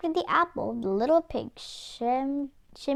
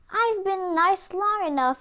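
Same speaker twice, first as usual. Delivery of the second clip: wolf losing temper; 8 semitones higher than usual. Acted. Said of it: "he cried".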